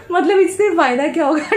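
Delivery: cracking up at papa's words; laughing while repeating. Real, not acted. Vlogger laughing at her father's question, through real laughter. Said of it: "हम लोग इतना हंसे".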